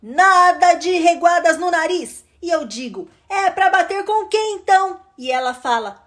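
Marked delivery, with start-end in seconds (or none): 0.0-2.2 raised, higher scolding voice; exasperated parent's telling-off; mother scolding. Acted. Mother scolds squabbling children. 3.3-5.0 put-on high, cheeky kid's retort; child talking back. Child talks back to mother.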